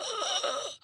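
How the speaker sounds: imitating a gasp, choking for breath; retold gasp. Mother in labor can't breathe, gasping sharply. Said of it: "I couldn't breathe".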